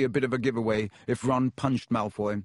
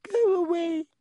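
high tiny squeak. Acted. Hermione hiding in the stall, squeaking high. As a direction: squeak it out, much higher. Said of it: "Hermione squeaked".

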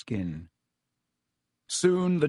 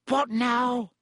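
high, urgent question; strained, anxious high voice; desperate; 11 semitones higher than usual. A narrator's reading. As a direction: call it out at a loss. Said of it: "sounding desperate".

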